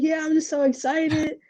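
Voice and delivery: high, choked-up happy tears; a light imitation of crying with joy; teary excitement. Player telling her agent on the phone, mock teary excitement. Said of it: "I was like".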